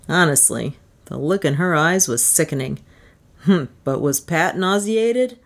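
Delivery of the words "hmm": plain reading voice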